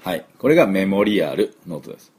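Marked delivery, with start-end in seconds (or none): 0.7-1.5 メモリアル in even katakana syllables, "me-mo-ri-a-ru"; メモリアル with Japanese vowels after every consonant; Japanese-style pronunciation. Shown as a demonstration. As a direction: sound out "a-ru" fully at the end of メモリアル. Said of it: "音節がない".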